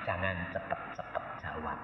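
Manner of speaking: very soft, low caution; retold inner prompting, barely voiced; hushed warning. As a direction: warn under your breath. Holy Spirit quietly warns him.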